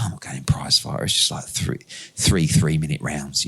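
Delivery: low, voiceless imitation of the boxer brushing it off; hushed dismissive retort. Boxer scoffing at the tournament offer, hushed and voiceless. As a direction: breathe it out as if the offer is beneath him.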